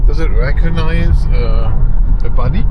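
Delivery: talking while the car shakes; on a bumpy road. Real, not acted. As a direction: voice jolted by a bumpy ride. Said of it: "My camera is shaking so much".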